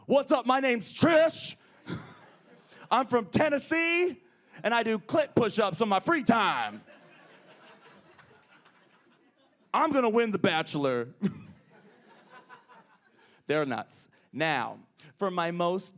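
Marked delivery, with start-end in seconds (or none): 0.0-11.1 much higher, louder, brash voice; a loud Tennessee reality-show contestant introducing herself; brash reality-show woman. Retold or imitated. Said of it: "Watch a straight woman on an American reality T V show".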